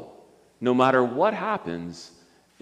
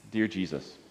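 lower, soft prayer lines for others to repeat; 4 semitones lower, 7 dB quieter, slower than usual. Acted. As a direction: lead a gentle prayer phrase by phrase. Leads prayer for repetition.

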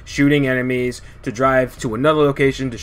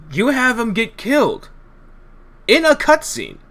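exasperated rant; 10 semitones higher than usual. Real, not acted. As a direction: vent in disbelief. Ranting about boss killed offscreen.

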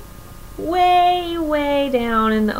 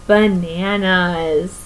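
ordinary delivery, nothing marked

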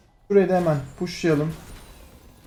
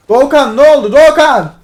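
much louder and higher, yelling the name; exasperated shout; 7 semitones higher, 12 dB louder than usual. Real, not acted. Streamer yelling at teammate Doğukan over his mistake. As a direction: explode at the teammate's blunder. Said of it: "Bağırtma beni".

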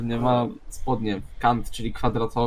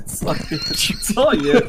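laughing; 13 semitones higher, 5 dB louder, much slower than usual. Real, not acted.

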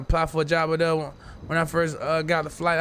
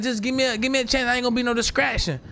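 higher, pleading mock voice; begging the manager for a chance; retold pleading; 7 semitones higher than usual. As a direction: beg for a chance. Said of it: "so I'm like".

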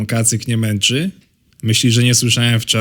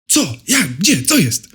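startled awake; 7 semitones higher, slower than usual. Acted. Baby wakes startled.

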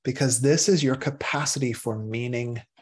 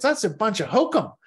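scoffing dismissal; 9 semitones higher, 3 dB louder than usual. Acted. Mock scolding dismissal.

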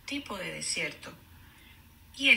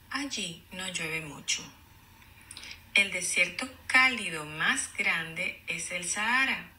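clear, even read-aloud; storybook reading voice; reading to children. Acted. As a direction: read the page to the class. Teacher reads book aloud.

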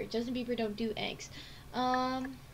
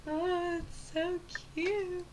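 cooing; 6 semitones higher, much slower than usual. Real, not acted. Cooing at a cute message.

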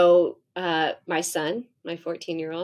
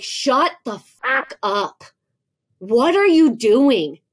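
slow, emphatic angry snap, pitched up; snapping back; 5 semitones higher, 6 dB louder, slower than usual. Retold or imitated. Mother snapping back at her teenager's instruction, angry and emphatic. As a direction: snap back hard, drawing out each word. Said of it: "I often will snap back".

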